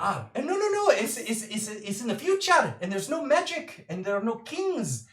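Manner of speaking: high, quick, earnest explaining; eager Birdie. Acted. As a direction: pile on details with nervous enthusiasm. Birdie again defends her setting.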